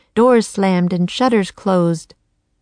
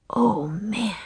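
soft, breathed amazement; awed whisper; 2 semitones higher, 6 dB quieter than usual. A narrator's reading. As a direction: whisper it in wonder. Jack awed by the castle.

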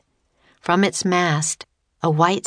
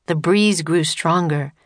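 ordinary delivery

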